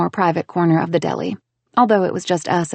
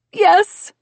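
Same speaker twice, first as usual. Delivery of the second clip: croaked; 12 semitones higher, 9 dB louder than usual. Acted. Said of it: "she croaked".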